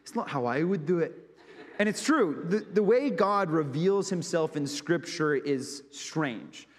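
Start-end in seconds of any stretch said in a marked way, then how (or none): 0.0-1.3 a playful imitation of a friend's accent, with a laugh; laughing mimicry of a friend's accent; Lloyd's accent again. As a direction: quote it to yourself in his accent, laughing. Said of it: "being completely obsessed with Lloyd's accent".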